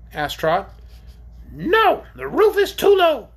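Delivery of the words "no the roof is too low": loud, high creature squawk; shrill orc. A narrator's reading. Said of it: "his shrill voice".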